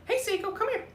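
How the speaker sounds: high coaxing pet voice; coaxing the cat. Real, not acted. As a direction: coax her over sweetly.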